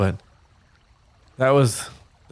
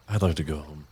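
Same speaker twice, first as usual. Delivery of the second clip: softer, lower small voice; timid; 5 semitones lower, 8 dB quieter than usual. Acted. Kabosh shrinking under all eyes, soft timid voice. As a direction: shrink away. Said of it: "shrinks a little bit".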